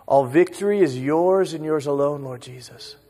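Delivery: usual speaking voice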